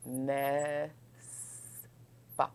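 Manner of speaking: "N'est-ce" drawn out, then "pas" breathed almost silently; slow pronunciation demo. Shown as a demonstration. Teacher to class, slowly modelling a pronunciation trick. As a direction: stretch "N'est-ce" and let "pas" fade out. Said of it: "our little secret to help you pronounce better".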